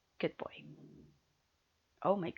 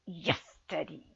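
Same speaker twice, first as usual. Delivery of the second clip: ordinary talk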